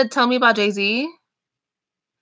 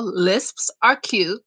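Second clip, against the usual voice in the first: slow insistence; much slower than usual. Real, not acted. Defending lisps to a viewer.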